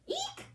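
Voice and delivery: tiny high squeaky eek, soft; a startled little squeal; shriek. Acted. Daisy waking to three bears staring, high soft shriek. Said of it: "she shrieked".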